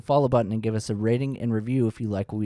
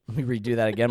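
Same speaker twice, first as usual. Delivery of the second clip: ordinary delivery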